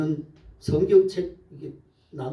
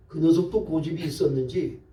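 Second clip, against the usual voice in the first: normal talking voice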